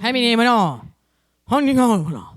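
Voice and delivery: high, loud cartoon nonsense; loud gibberish voice. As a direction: shout nonsense like a scribble monster. Doodle Bob gibberish reprise.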